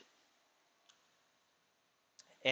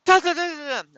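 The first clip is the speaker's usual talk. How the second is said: high, loud da-da-da babble for a yelling voice; shrill loud gibberish imitating the ex's blow-up; mock ranting gibberish; 12 dB louder, faster than usual. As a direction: imitate a phone rant as loud nonsense syllables. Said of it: "right when she blew up".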